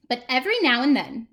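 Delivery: ordinary delivery, nothing marked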